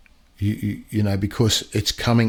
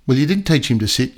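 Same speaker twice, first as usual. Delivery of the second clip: normal talking voice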